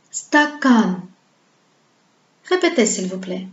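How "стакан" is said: "stakan" with short "sta" and long, insistent "kan"; exaggerated final stress in "stakan"; long stressed syllable. Shown as a demonstration. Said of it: "elle se prononce longue".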